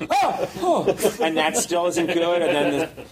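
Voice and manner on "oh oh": startled oh. Retold or imitated. Startled by small skeleton.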